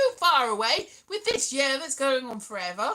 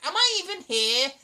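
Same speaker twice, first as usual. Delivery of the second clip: normal talking voice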